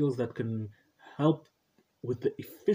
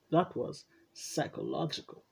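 hammed-up, higher-pitched title reveal; dramatic title delivery; 3 semitones higher, 4 dB quieter than usual. Shown as a demonstration. Podcast host showing how to say the title, hammed-up drama. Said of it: "You gotta say it like that".

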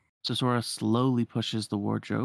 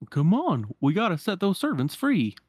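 raised, loud eager hero; rallying hero voice; 8 semitones higher, 5 dB louder than usual. Acted. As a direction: urge the team on.

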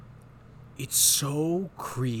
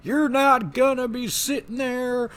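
loud, much higher, slow mock yelling; mock drill sergeant yell; 12 semitones higher, 6 dB louder than usual. Podcast host voicing a drill sergeant screaming at recruits, mock yelling. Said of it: "the story where he's like".

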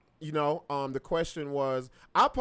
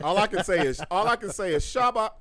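put-on Jamaican accent; 3 semitones higher, 4 dB louder than usual. Jamaican impression bit.

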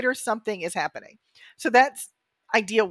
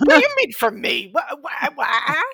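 higher, louder, sputtering in mock offense; offended sputter; 5 semitones higher, 5 dB louder than usual. Hurt by a passing comment, mock-offended sputtering. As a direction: stammer like the remark stung. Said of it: "it's something like".

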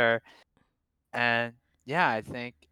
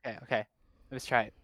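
usual speaking voice

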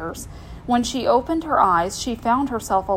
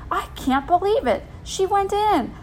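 high-pitched astonishment; disbelief; 9 semitones higher than usual. Acted. Boy whose cousin went in, disbelieving. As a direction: react in stunned disbelief. Jimmy stunned she went in.

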